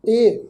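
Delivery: ਇਹ as a single e sound; correct pronoun reading. Shown as a demonstration. Teacher giving congregation the correct reading, ਇਹ as plain long e. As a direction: say ਇਹ as e, with no o ending. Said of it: "ਇਸ ਦਾ ਪਾਠ ਹੈ ਜੀ".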